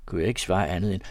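plain reading voice

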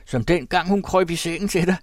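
high, loud mocking shout; husband shouting taunts about his wife. A narrator's reading. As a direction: bellow the taunt. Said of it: "råbte han".

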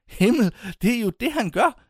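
the penny drops all at once; Himmel, det er jo det: said in a rush of amazement; astonished realisation. A narrator's reading. Sudden realisation he smiles.

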